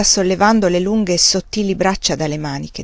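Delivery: ordinary delivery, nothing marked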